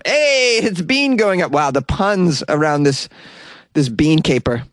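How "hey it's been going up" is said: high, punning exclamation; high-pitched "hey!" at a bad joke; mock excitement. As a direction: pounce on the pun with a raised voice. Cheering his discovery of the pun.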